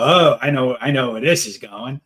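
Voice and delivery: loud, knowing "oh" of recognition. Acted. Speaker catching their own emotional shift, loud and knowing. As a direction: call it out as if spotting a familiar plot. Acting out recognizing a familiar pattern.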